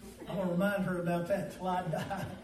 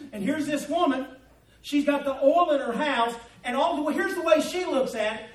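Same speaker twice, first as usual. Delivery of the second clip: raised voice, slowing into drawn-out phrases; forceful preaching; 4 semitones higher, 6 dB louder than usual. Acted. Preacher voicing how the woman sees it, forceful preaching. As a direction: preach it with mounting volume.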